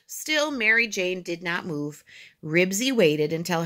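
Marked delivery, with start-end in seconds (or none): none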